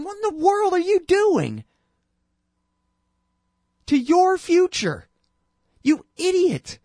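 grab him by the collar and yell, hammed-up; exasperated mock shout. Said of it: "shaking the crap out of him and saying".

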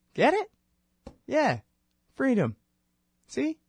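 very high, squealing glee; mock elation. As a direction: gush like it's obvious. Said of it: "mock-excited punchline".

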